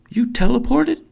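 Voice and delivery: high, loud, rising; astonished question. Acted. Child to brother who moved by magic, astonished. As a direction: ask it high in disbelief.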